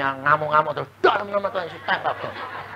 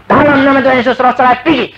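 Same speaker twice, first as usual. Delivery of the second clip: loud, higher rebuke; commanding the spirit out; 7 semitones higher, 7 dB louder, faster than usual. Retold or imitated. Husband and wife driving out the divorce spirit, loud command. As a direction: cast the spirit out with force. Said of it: "Ayo kita usir".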